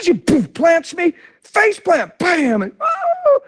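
ordinary talk, nothing marked

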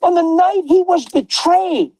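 high, driving preacher's cry with shouted peaks; preaching at full force. Acted. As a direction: lift the room like a pulpit.

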